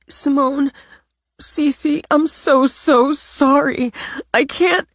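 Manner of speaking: high and slow, breaking on so sorry; tearful apology. Acted. Natalie begging both of them, high and tearful. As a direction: plead through tears.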